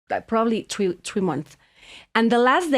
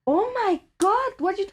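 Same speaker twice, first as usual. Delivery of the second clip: much higher, urgent acted voice; retold excited alarm; 13 semitones higher than usual. Husband spotting the couple passing the boat, high-pitched excitement. Acts husband's startled reaction.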